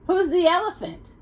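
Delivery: ordinary delivery, nothing marked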